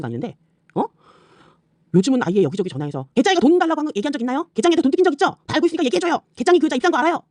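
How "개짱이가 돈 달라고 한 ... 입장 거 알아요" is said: high, sugary mock voice of her fishing for gossip; mocking her phone calls. Mimicking her calling around to dig up dirt, in a high mock voice. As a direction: parody her asking strangers, pitched way up. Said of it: "이렇게 하고 다니더만".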